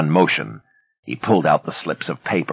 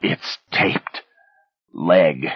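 hoarse, half-voiced broken mumble; much slower than usual. A narrator's reading. Beaten Ben mumbles hoarsely where it's hidden. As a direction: rasp out the confession. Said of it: "Then he mumbled hoarsely".

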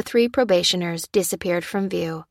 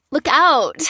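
much higher, loud alarmed shout; warning cry; 8 semitones higher, 6 dB louder than usual. A narrator's reading. The stranger crying a warning to Lucy, loud alarm. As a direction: warn her, fast and sharp. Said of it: "cried the man".

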